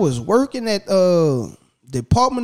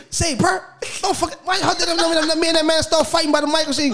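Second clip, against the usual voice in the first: much higher, heated retold complaint; mad protest; 10 semitones higher than usual. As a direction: confront them, indignant and wound up. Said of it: "I walk back down there mad".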